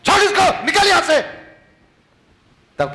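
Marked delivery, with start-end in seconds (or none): 0.1-1.2 high loud rebuke; commanding shout. Shown as a demonstration. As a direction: order it out with full authority. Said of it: "क्या बोलते?".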